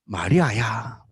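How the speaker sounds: low, hushed voice of Jesus calling Mary; gentle call of Jesus. Acted. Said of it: "창조적 발성은 딱 한마디였습니다". (낮고 부드럽게 이름을 부르는 예수님의 목소리)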